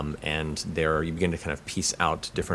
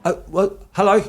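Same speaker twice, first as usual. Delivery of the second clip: much higher, startled guard voice; Luther; 9 dB louder than usual. Acted. Guard surprises someone leaving the bush, high hesitant character voice. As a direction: pitch it way up, hesitant greeting. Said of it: "a voice goes".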